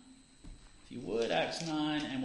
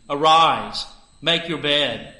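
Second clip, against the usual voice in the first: a louder, ringing command to rise; 3 semitones higher, 14 dB louder, slower than usual. Acted. Peter ordering the bedridden man to rise, loud command. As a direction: call it out with authority.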